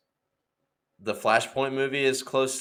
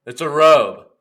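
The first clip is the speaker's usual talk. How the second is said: loud, insistent correction; hammed-up drunk snapping back; 5 semitones higher, 12 dB louder, slower than usual. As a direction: drunk man insists to bar neighbour, loud and sure. Drunk insisting in bar story.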